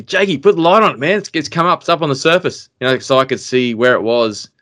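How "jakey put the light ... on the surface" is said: higher-pitched panicked call, re-enacted; retold yell. Angler to his mate on the boat, retold yell. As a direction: act out calling urgently to your mate. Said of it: "I yelled at Jakey".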